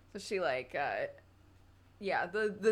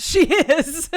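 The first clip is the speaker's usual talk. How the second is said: bursting into laughter, higher and louder; laughing; 10 semitones higher, 16 dB louder than usual. Real, not acted. Joking that she is Cockney, laughing. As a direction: laugh the words out.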